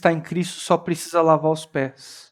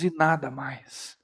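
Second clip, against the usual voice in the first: soft emphasis; 6 semitones lower, 12 dB quieter, slower than usual. Acted. Repeated fading close.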